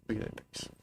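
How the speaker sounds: whispered admiring aside; whispering to the viewers about the cat. Real, not acted.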